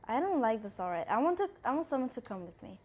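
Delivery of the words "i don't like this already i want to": normal talking voice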